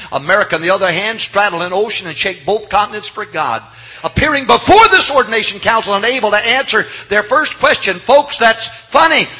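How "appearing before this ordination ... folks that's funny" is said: rising louder and higher toward a shout; building preaching. Real, not acted. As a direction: build the fire line by line.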